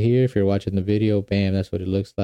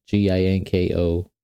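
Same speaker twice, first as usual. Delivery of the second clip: ordinary talk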